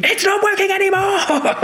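sudden high squeal of alarm; panicked squeal. Retold or imitated. Mimicking himself as the sedation wears off, high panicked squeal. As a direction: voice leaps up as the calm snaps.

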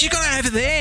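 very high, exaggerated snap; mock her way over the top; hammed-up snippy greeter. Mocking the greeter again, very high and snippy. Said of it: "Julie bit me out".

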